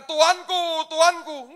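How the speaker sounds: loud, high call of the wrong word; hammed-up loud call to a master; mock cry. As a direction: shout it as the wrong version. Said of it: "justru teriaknya bukan".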